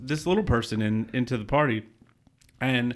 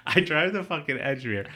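ordinary talk, nothing marked

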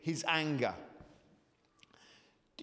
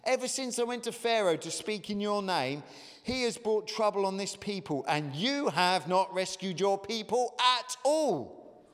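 petulant and aggrieved, voice pitched up and louder; 4 semitones higher, 3 dB louder than usual. Retold or imitated. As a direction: complain to God like a wronged child. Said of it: "Do you get the petulance in Moses' voice".

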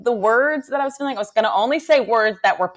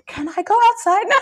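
put-on much higher, small stunned child; timid kid voice; 12 semitones higher than usual. Mom imitating her startled son asking to go outside, higher timid voice. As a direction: ask meekly to escape.

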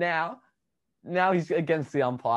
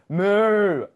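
loud hammed-up moo, louder; mock cow moo; 5 semitones higher, 8 dB louder than usual. Host mooing to start his beef of the week. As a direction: bellow a cow moo to open the segment. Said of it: "my beef of the week, you know, put it on".

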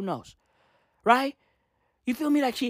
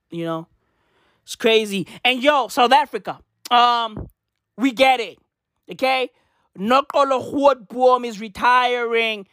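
normal talking voice

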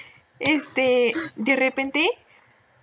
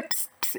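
a soft, voiceless hiss to call someone over; the stranger in the waiting room hissing at them, a voiceless psst; imitated psst; 15 dB quieter than usual. Man hissing to get attention.

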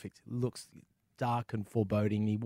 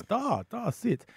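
put-on light comic Russian accent, pitched up; General Yuri; 12 semitones higher, much slower than usual. Russian general inviting the travel writer to sit, mock accent. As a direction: offer a seat in a rough Russian accent. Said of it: "I'm terrible with accents".

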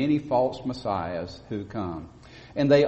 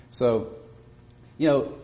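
ordinary talk

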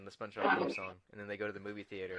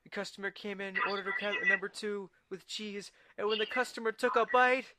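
high put-on voice building to a shout; hammed-up cartoon outcry; 10 semitones higher, 6 dB louder, slower than usual. Retelling the burger crisis to friends, building to a mock shout. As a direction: climb from high quote to loud mock scream. Acts out movie line, building.

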